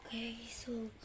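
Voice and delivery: whispering. Real, not acted. Whisper before counting.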